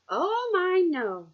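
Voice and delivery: lightly voiced old lady, high on oh my; kind old woman. A narrator's reading. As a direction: gently correct her as a sweet grandma. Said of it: "replied the kind old woman".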